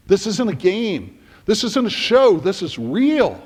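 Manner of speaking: building panic as it sinks in; panicked realization. Retold or imitated. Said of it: "it suddenly occurs to Steve Martin".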